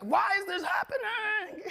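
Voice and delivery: high, loud whining cry; hammed-up shout at the heavens; mock exasperated shout. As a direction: blurt it out like you're fed up. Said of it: "you don't wanna be like".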